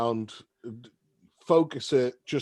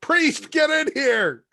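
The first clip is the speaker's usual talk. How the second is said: high, loud summons; urgent call; 12 semitones higher, 6 dB louder, slower than usual. Acted. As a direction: holler for help into the next room. Character calls for the priest.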